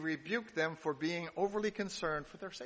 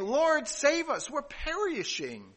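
high, drawn-out plea of alarm; acted plea, pitched well up; desperate cry for help; 9 semitones higher, 5 dB louder, slower than usual. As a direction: cry out to be saved, stretching the words. Disciples' cry to Jesus read out.

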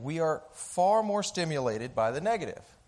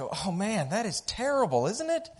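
mock dismay; 7 semitones higher than usual. Neighbors lament to the farmer.

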